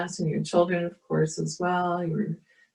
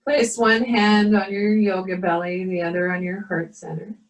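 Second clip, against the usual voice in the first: guided meditation; 2 semitones higher than usual. Acted. Leads a yoga meditation.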